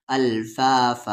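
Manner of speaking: अल्फाफा slow, ending on long open aa; stopping form. Shown as a demonstration. Teacher showing the pause form, slow अल्फाफा ending open. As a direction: stretch both long vowels in अल्फाफा and stop. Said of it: "रुकने की सूरत में".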